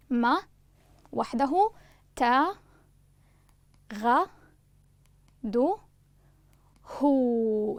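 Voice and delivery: each syllable spoken apart with pauses; syllables one by one. Shown as a demonstration. Teacher dictating the syllables one by one to students. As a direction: count out the syllables one at a time. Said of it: "الآن حرف الباء المتحرك سيأتي وحده".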